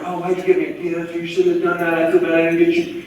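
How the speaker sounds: mock embarrassed, apologetic whine; flustered gift recipient. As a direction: fret guiltily over being given a present. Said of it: "then they get all".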